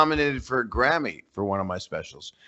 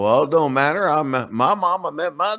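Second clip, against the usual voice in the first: higher-pitched Southern local; Southern accent; 7 semitones higher, 3 dB louder than usual. Acted. Comedian playing a local defending young marriage, Southern accent. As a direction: defend it in a raised Southern twang. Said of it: "had to put a Southern accent".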